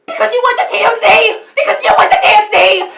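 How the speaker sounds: high, loud screamed accusation; imitating a screaming meltdown, laughing at the end; mock screaming. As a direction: shriek it like a breakdown. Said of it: "he's like".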